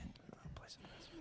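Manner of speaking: whispered aside, voice dropped to breath. Real, not acted. Pastor whispers an aside while placing a gift in the basket. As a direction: whisper it so the mic barely catches it.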